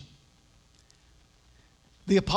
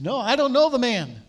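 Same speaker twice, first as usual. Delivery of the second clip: higher, louder, a sharp denial; panicked denial; 6 semitones higher, 7 dB louder than usual. Retold or imitated. Peter denying he knows Jesus, loud and pushed. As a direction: blurt it out to get them off his back.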